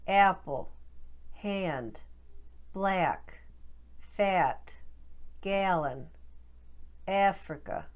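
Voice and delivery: Boston short a with eh off-glide on each word; Boston accent. Shown as a demonstration. Coach drills Boston short a words for practice. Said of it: "Let's try a few more for practice".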